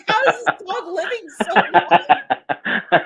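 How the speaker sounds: slow, laughing incredulous question; laughing quote. Retold or imitated. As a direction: ask it through laughter, drawing it out. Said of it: "and I'm like".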